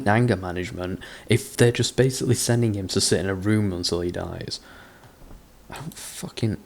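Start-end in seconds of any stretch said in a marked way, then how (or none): 5.7-6.6 whispered, much softer, giving up; exasperated whisper. Real, not acted. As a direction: breathe it out in defeated disbelief. Exasperated at the story.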